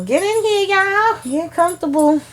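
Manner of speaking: high-pitched, drawn-up welcoming call; calling viewers in. Real, not acted. Host beckons viewers into the recap, high welcoming call. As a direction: usher everyone in on a high pitch.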